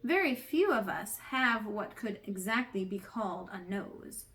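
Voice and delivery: plain reading voice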